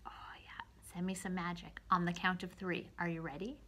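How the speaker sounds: breathy magic whisper. Pretend. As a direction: draw them in with a hush.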